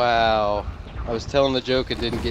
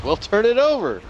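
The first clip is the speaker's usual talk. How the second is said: much higher, a sly deadpan tip; punchline; 11 semitones higher than usual. Retold or imitated. Bartender tells the patron the trick, sly. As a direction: toss off the punchline.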